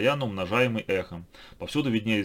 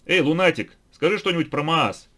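calling out; 7 semitones higher, 7 dB louder than usual. A narrator's reading. Calls out to the madman.

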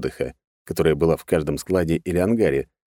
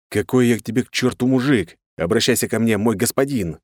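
smug, slightly raised master's tone; smirking; 6 semitones higher than usual. A narrator's reading. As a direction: correct him with a smirk. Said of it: "ухмыльнулся я". (с ухмылкой, по-хозяйски)

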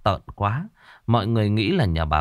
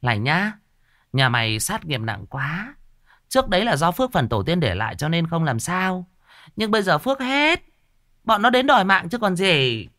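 stern shaman; 7 semitones higher than usual. Acted. Shaman warning the old woman of ghosts, high and bossy. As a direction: talk down to the client.

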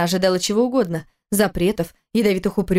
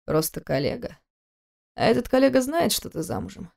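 mocking; 2 semitones higher, 4 dB quieter than usual. A narrator's reading. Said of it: "Спросил он с издёвкой".

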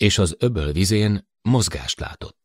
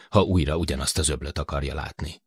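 usual speaking voice

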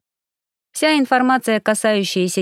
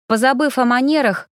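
usual speaking voice

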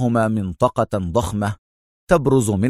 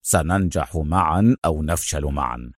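low, quietly resolute; firm Adham; 6 semitones lower, 4 dB quieter than usual. A narrator's reading. Adham to his injured companion, firm and low. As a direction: hold firm in a lower voice. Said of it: "وهو يقول في حزم".

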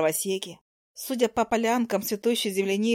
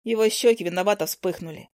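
ordinary delivery, nothing marked

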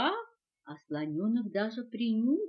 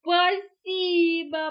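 high, loud, drawn-out thanks; delighted; 7 semitones higher, 9 dB louder, much slower than usual. A narrator's reading. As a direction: stretch out a joyful thank-you. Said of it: "обрадовался слонёнок". (радостно)

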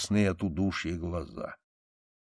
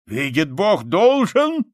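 loud cry leaping high at the end; soldier's effortful yell; strained shout; 8 semitones higher, 8 dB louder, slower than usual. A narrator's reading. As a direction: force it out, straining upward. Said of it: "закричал солдат с натугой".

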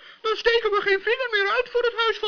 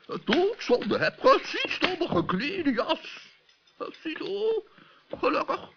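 low, halting professor voice full of ehs; an absent-minded old scholar fumbling for words; low hesitant professor stammering; doddering professor; 4 semitones lower, 5 dB quieter than usual. Acted. Professor voice held.

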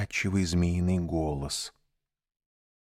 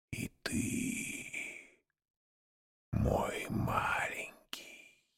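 slow, soft hypnotic hiss; sly honeyed whisper; 7 dB quieter, much slower than usual. A narrator's reading. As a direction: lull him into sleep. Snake hypnotises the boy.

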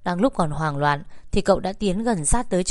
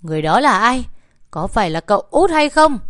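loud, high-pitched furious demand; angry shouting; 4 semitones higher, 6 dB louder than usual. A narrator's reading. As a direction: shout the questions, climbing higher. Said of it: "cậu Ngọc liền quát ầm lên". (quát ầm lên giận dữ)

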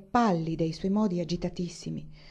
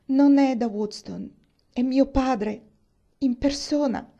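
louder, higher and slow on the first words; Eleanor dreading; 5 semitones higher, 5 dB louder, slower than usual. A narrator's reading. Eleanor tells Catherine her father returned, louder and higher. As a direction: drag out the dreaded news.

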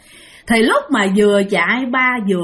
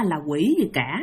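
ordinary delivery, nothing marked

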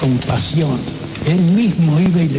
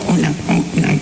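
imitating a nasal-voiced man, talking through the nose; a retold nasal thug, half-imitated; 2 semitones higher than usual. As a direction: put on a nasal twang to mock him. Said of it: "un tipo gangoso que hablaba así".

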